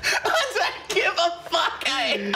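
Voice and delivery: high, slow, laughing through words; breaking up with laughter. Real, not acted. Cracking up after dark joke.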